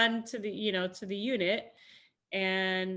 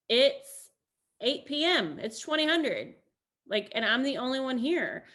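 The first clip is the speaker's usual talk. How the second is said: higher, louder retold protest; exasperated protest; 5 semitones higher, 5 dB louder than usual. Soldier retelling her objection to the commander, exasperated. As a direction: protest in disbelief, hitting the key words.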